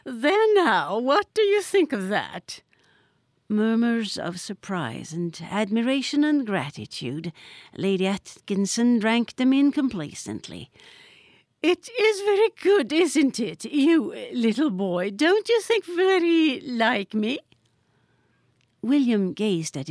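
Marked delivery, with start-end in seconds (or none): 0.0-2.6 high, gracious society lady voice; gracious grand lady. A narrator's reading. 11.6-17.4 high, gracious society lady voice; gracious grand lady. A narrator's reading.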